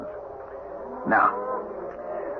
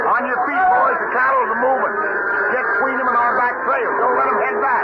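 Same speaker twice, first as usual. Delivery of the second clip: urgent calling out; 9 semitones higher than usual. Acted. Boss waking the hands, stampede.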